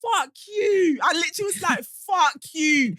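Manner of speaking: high, emphatic retold yell; retold shout. Driver yelling back at a van driver, shouting. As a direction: shout it back as you did then. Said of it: "of course I'm shouting back".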